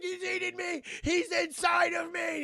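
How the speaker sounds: over-the-top frightened wail; hammed-up terror. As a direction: scream it as if being eaten alive. Victim screaming in terror.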